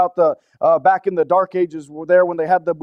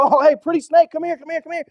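mock cooing; 7 semitones higher than usual. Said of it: "do you go".

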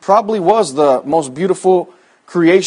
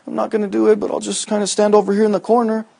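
mock timid mumble, much softer; timid and shy; 3 semitones higher, 7 dB quieter than usual. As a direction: shrink into the corner, mocking. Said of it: "back up all timid and shy".

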